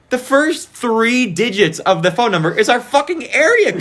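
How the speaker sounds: high-pitched quote half laughing it out; mock outrage breaking into laughter; laughing disbelief. Griping to friends about leaked numbers, laughing incredulously. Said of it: "I'm, like, thinking".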